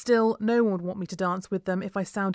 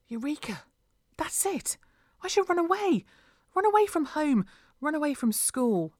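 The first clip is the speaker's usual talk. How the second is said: high-pitched, breathless-with-the-idea excitement; excited realisation; 8 semitones higher than usual. A narrator's reading. Leah suddenly deciding to run away, excited. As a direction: seize on the plan with delight.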